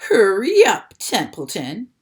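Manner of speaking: loud mock cry of Wilbur; the rat mocking Wilbur's urgent plea; mocking imitation of Wilbur. A narrator's reading. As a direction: ape the pig's plea. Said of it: "imitating Wilbur's voice".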